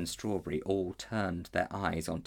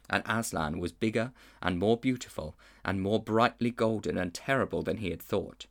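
ordinary delivery, nothing marked

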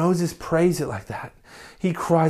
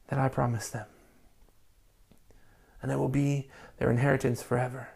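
soft; 4 semitones lower, 6 dB quieter than usual. Acted. God's promise quoted in scripture.

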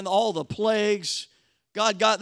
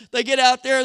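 ordinary talk

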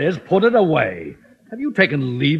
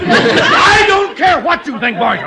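exasperated yell; 12 semitones higher, 5 dB louder than usual. Acted. Fed-up boss yells at teacher.

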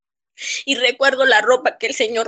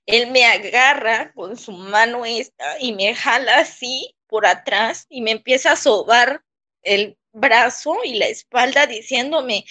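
usual speaking voice

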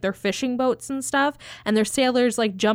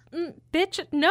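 sassy redhead; 6 semitones higher than usual. Retold or imitated. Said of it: "Anybody who has red hair is like".

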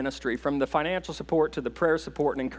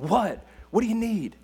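imitating an irritated teenager, mildly raised pitch; emphatic teen reply; 5 semitones higher than usual. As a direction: snap back like an annoyed fourteen-year-old. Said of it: "you just get an emphatic response".

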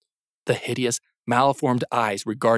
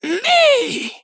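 put-on eerie child's call; 5 dB louder than usual. Kelsey's voice calls from darkness.

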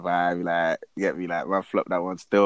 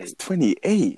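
surprised; 6 semitones higher, much slower than usual. Real, not acted. Echoes the age in disbelief.

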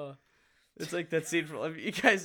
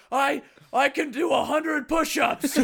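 slow, much higher and louder mock shout; mock argument yell; 13 semitones higher, 12 dB louder, slower than usual. Retelling the skit's family fight, mock shouting. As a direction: bellow the brag mid-argument. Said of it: "burst out into argument".

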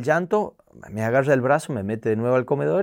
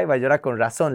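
normal talking voice

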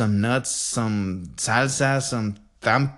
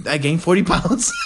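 laughing; 8 semitones higher than usual. Real, not acted. Laughing at own joke.

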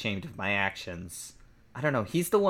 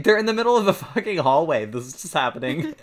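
ordinary talk

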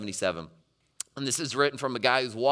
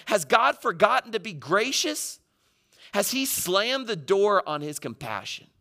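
high, slow, stretched-out plea; acted cry of abandonment; emotional questioning; 8 semitones higher than usual. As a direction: press the question with raised pitch. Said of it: "some real emotions".

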